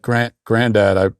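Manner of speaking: hesitant mock complaint to Granddad; whining grandson. Grown grandson confiding to his granddad about playing catch, mock-hesitant whining. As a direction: stammer it out weakly, trailing into unfinished thoughts. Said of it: "you went to him and said".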